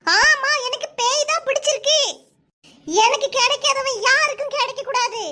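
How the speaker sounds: high, wild ranting with a manic laugh; possessed ranting. Acted. Daughter screaming at her parents, possessed and laughing. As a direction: rave like a woman possessed by a ghost. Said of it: "Peyadi பிடிச்சிருக்கு உனக்கு".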